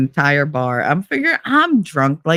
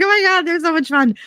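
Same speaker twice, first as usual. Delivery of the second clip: gleeful; 11 semitones higher than usual. Retold or imitated. Gleeful quote, very high.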